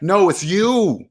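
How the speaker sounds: drawn-out, each word weighed; slow it right down to land it; slow pointed insistence; slow emphatic. Real, not acted.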